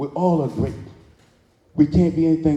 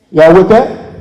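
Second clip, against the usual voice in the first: calling out; 6 semitones higher, 10 dB louder, slower than usual. Real, not acted. Preacher calling to the crowd.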